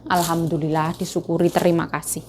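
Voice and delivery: low, soft murmured thanks; quiet grateful murmur. Real, not acted. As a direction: whisper your thanks to yourself. Grateful murmur after sweet words.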